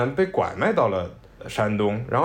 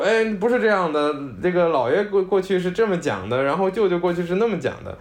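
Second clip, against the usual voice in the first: higher-pitched mock interjection, half-playful; a light impression of a relative cutting in; imitating a relative correcting; 7 semitones higher than usual. Mother and cousin butting in on grandma's story, a lightly voiced higher objection.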